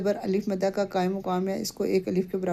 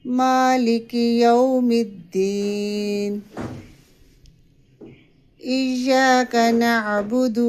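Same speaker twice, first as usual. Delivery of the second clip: slow, drawn-out tajweed recitation; measured Quranic chant; 3 semitones higher, 3 dB louder, much slower than usual. Shown as a demonstration. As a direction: chant each word carefully. Recites verses as model.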